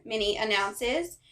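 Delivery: usual speaking voice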